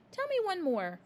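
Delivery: a hint of an eager young teacher; Miss Honey. A narrator's reading. Miss Honey voiced in read story.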